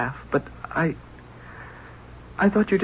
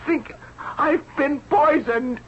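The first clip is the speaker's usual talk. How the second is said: high, strained voice, suddenly ill; sick and frightened; 8 semitones higher than usual. Acted. Prince tells the tailor he's poisoned, high and panicked. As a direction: gasp it out as the sickness takes hold.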